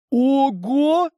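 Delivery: high, loud amazed "Ого!"; surprised. Acted. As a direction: gasp it out in amazement. Elf sees the new guns.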